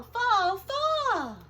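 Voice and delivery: dramatic falling crash; building crash. Acted. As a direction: let the walls tumble big. Crumbling wall builds up.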